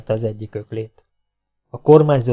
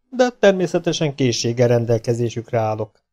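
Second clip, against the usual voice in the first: ordinary delivery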